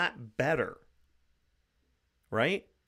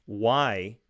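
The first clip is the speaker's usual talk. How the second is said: ordinary talk, nothing marked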